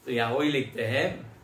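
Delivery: ordinary talk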